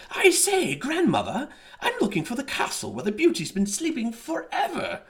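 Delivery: high, loud aristocratic voice; posh marquis. A narrator's reading. Marquis asking the wolf for the castle, high pompous voice. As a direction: play a pompous nobleman.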